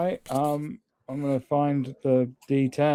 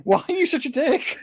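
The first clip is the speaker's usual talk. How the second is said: high-pitched mock outrage; high, indignant squeal of a question; mock indignation; 9 semitones higher than usual. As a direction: protest like a wronged friend. Players' teasing mock quarrel.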